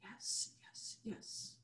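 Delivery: whispered yeses on the exhale; soft affirming whisper; breathy whisper. Real, not acted. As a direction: breathe yes out softly. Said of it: "Take an inhale right now with me".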